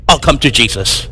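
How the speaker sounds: ordinary delivery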